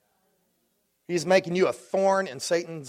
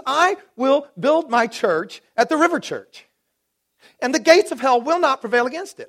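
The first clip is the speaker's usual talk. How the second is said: voicing the Lord; 8 semitones higher, 4 dB louder than usual. Lord speaking over the River Church, high solemn declaration. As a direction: declare it as God's own word. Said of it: "He says".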